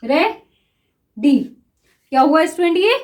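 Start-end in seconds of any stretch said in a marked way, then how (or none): 0.0-1.4 ready stretched very slowly while writing it; ready spoken slowly in time with the shorthand stroke; drawn out. Shown as a demonstration. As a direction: drag out ready as the pen moves. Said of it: "देखिए".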